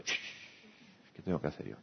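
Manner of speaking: whispered shh, then a low murmured question; hushed inner voice. Pretend. Telling oneself to hush and look inward, whispered. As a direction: hush yourself and ask under your breath.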